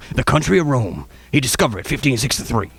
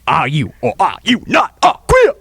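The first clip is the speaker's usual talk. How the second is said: rising to a high, loud demand; hammed-up courtroom badgering; mock interrogation; 12 semitones higher, 3 dB louder than usual. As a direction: grill him louder and higher until it's a shout. Host mock-interrogates his guest.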